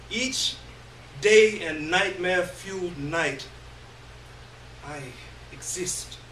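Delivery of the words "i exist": ordinary delivery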